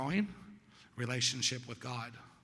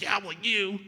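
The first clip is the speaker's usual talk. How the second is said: loud, higher-pitched comeback; angry retort; 7 semitones higher, 7 dB louder, slower than usual. Retold or imitated. Apologizer flaring up at coworker again, angry retort. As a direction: fire the blame straight back. Retold second flare-up at coworker.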